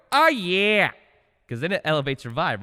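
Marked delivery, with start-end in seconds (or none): 0.1-0.9 loud, high drawn-out "Oh, yeah"; hyped catchphrase. Shown as a demonstration. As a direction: shout it to lift your mood. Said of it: "Or you could go".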